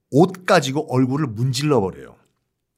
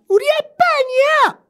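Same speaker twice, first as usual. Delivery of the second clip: put-on very high, fast childlike questioning; small girl calling for her dad; little daughter voice; 4 dB louder than usual. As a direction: squeak out a hopeful child's call.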